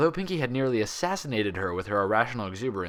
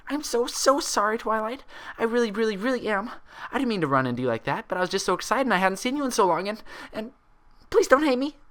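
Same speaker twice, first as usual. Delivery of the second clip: rushed guilty plea; 13 semitones higher than usual. A narrator's reading. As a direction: tumble out the apology in panic. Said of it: "came out in a rush ... guilt and fear".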